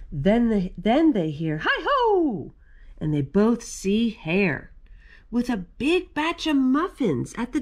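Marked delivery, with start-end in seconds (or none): 1.6-2.5 high sing-out greeting, falling at the end; calling out. A narrator's reading. Hare hailing friends at the lair door, calling out. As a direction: call a cheery hello from the doorway.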